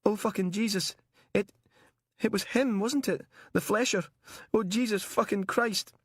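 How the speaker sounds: high-pitched tearful distress; starting to cry. Acted. Captive realising the Flesher took him, crying. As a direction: break into horrified, tearful swearing, pitched high. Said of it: "He started to cry".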